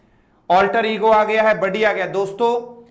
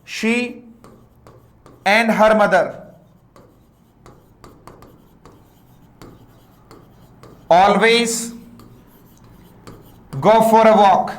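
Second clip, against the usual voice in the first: slow dictation; much slower than usual. Shown as a demonstration. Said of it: "teacher dictates the new sentence".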